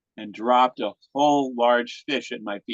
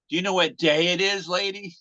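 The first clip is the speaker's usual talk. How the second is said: teasing disbelief; 8 semitones higher than usual. Retold or imitated. Guests tease the lady.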